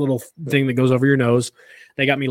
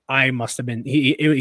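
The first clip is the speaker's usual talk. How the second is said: usual speaking voice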